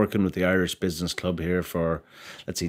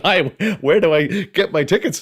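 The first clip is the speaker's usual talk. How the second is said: higher, louder, amused retelling; eager request; 10 semitones higher, 7 dB louder than usual. Retold or imitated. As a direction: play an over-keen customer, half laughing. Acts out eager ticket request.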